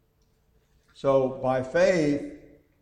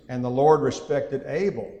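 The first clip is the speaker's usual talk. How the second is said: Abel stressed louder than the rest; 6 dB louder than usual. Shown as a demonstration. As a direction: lean hard on the name Abel. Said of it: "I put the emphasis on the person".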